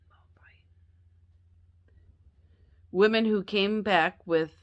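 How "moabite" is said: Moabite whispered under the breath, no voice at all. Shown as a demonstration. Teacher whispering Moabite to herself after stumbling. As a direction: breathe Moabite silently as a private check.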